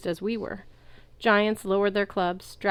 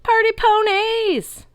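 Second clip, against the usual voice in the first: very high drawn-out party yell; long high whooping rally cry; 8 dB louder, much slower than usual. A narrator's reading. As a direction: bellow a party battle cry. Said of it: "a centaur yelled".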